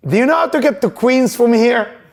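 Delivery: 일부러 센 척하는 목소리로 묻기; trying to sound tough. Retold or imitated. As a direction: put on a tough-guy front, not quite convincing. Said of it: "I tried to sound tough".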